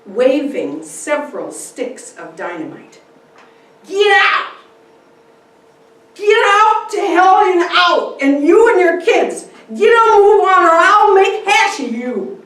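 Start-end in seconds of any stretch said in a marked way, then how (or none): 3.9-12.4 bellowed, crazed threat; drunk roaring. Acted. Drunk intruder roaring at the family, bellowing. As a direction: roar them out of the house.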